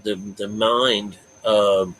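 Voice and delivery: normal talking voice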